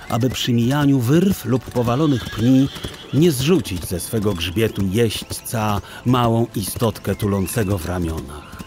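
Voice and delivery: ordinary delivery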